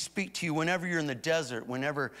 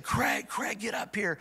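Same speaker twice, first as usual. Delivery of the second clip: mock urgent call from upstairs, higher; a retold wife's urgent call, pitched up; 4 semitones higher, slower than usual. Wife calling her husband upstairs, mock urgent call. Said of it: "my wife came calling from upstairs".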